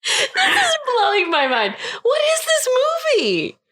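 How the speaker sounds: high, laughing disbelief. Real, not acted. Listener reacts to the ending, laughing in high disbelief. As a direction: laugh through the shock.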